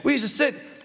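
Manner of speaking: high-pitched mock bickering; arguing. Sitting faction argues back and forth, high mock insistence. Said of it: "They argued".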